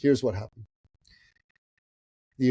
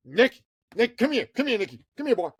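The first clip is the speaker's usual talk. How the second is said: much higher put-on voice; insistent older-guy impression; 11 semitones higher than usual. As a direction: call him over in a high character voice. Imitates guy calling him over.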